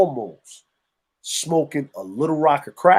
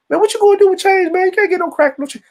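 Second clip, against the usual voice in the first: much higher, loud and fast, scoffing at him; high, fast taunt; mocking high retort; 4 dB louder, faster than usual. Telling friends how a guy scoffs at the addict, loud and high.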